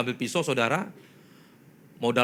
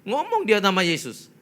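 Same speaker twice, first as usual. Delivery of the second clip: normal talking voice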